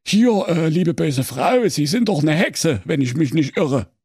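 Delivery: high, hesitant, overly polite executioner; timid executioner voice. A narrator's reading. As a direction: address the witch nervously. Executioner addresses the witch.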